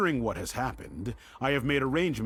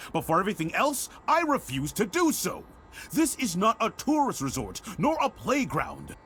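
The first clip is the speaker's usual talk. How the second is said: angry scolding; 8 semitones higher than usual. Acted. Headmaster scolds the runaway girl.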